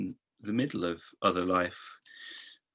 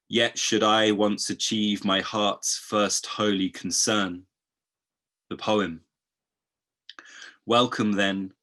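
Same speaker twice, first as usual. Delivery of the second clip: grave poetic recital; poem reading; 6 dB louder than usual. Acted. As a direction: read it like a solemn ode. Reads Hölderlin poem aloud.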